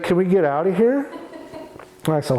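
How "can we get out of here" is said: a hint of the wife begging to get away; imitating wife. Wife pleading to leave, retold.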